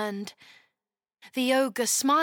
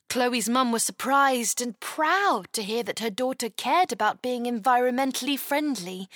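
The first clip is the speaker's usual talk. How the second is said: plain reading voice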